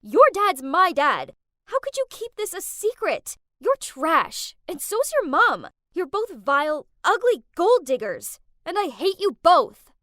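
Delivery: loud, furious scream; screaming down the phone at her half-sister. Acted. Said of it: "I screamed at her".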